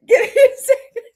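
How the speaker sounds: helpless real laughter over the words; laughing harder. Real, not acted. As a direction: lose it completely while asking for a second.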